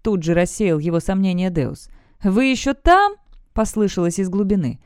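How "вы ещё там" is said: call from below. A narrator's reading. Voice calls up from below.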